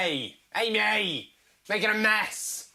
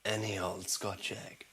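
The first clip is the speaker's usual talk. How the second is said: hushed whisper on homemade; whispered reveal; 12 semitones lower, 11 dB quieter than usual. Pretend. Presenter revealing her homemade Scotch egg in a whisper. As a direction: whisper it like a secret.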